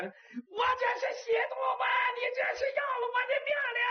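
very high, strained wail of complaint; agonized howling. Acted. Man hung by his feet protests to maids, wailing in agony. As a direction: howl each word out like a pig being slaughtered. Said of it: "像杀猪一样那么嚎".

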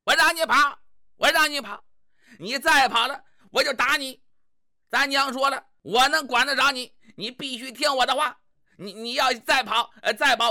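scolding wife. Acted. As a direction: lay down the law to him like a bossy wife.

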